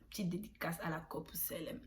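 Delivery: dédicace soufflée, sans voix; whisper. Real, not acted. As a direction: glisse la dédicace en confidence. Dédicace glissée en aparté. (dédicace chuchotée)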